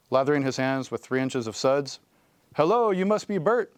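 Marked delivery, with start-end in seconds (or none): 2.5-3.7 high, put-on puppet voice for Bert's greeting; little boy doing a Muppet voice, pitched high; Sesame Street voice. A narrator's reading. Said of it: "he said in his best Sesame Street voice".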